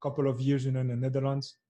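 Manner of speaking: ordinary talk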